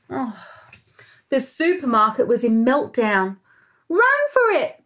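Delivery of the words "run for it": high panicked cry. Acted. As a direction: shout it in a panic. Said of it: "panicked Pepper".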